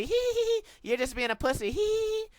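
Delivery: high, mocking hee hee; mocking girly giggle. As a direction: mimic her taunting giggle. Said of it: "They go".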